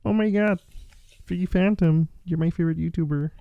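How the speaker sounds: high frog puppet voice; laughing through a Kermit bit. Acted. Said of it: "Kermit the Frog here".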